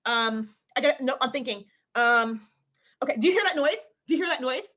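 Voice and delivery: acting out short-tempered interruptions; short, snippy. Actor to husband mid-audition, short and stressed. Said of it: "I get really short".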